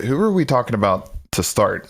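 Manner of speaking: normal talking voice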